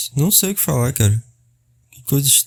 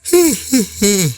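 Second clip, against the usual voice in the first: yawning; 8 semitones higher than usual. Real, not acted. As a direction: let out a big yawn. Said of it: "vou bocejar de novo".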